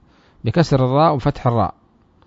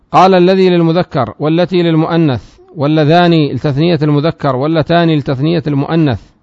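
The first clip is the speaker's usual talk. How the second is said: raised, louder recitation of the source text; reading the text aloud; 3 semitones higher, 5 dB louder than usual. Shown as a demonstration. Teacher reciting the grammar text to students, raised voice. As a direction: recite the matn line for the class.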